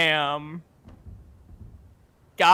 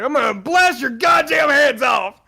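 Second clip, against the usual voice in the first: higher, louder gunfire yell; action hero shout; 7 semitones higher, 5 dB louder than usual. Shown as a demonstration. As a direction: bellow the threat. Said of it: "I got the best line".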